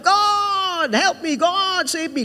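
high, drawn-out mock plea to God; crying out in prayer. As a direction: imitate people wailing out a prayer, pitched high. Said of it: "Loud prayers".

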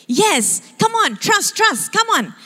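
high-pitched, insistent coaxing; eager urging. Retold or imitated. Abu urges his doubtful friends to follow, eager.

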